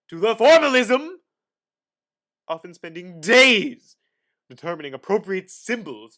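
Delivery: emphatic. Acted. Dramatic reading of history.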